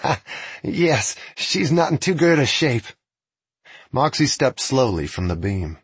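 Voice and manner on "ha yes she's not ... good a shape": higher, brighter laughing reply; cheerful young messenger. Acted. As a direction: laugh it off sheepishly. Messenger laughs at his horse.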